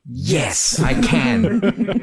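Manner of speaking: proud, rising high on the last word; mock heroic teen. Alfie answering Bandit, lightly voiced eager pride. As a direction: announce it like a tiny hero.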